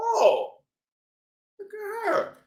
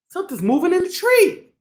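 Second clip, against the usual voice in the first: ordinary delivery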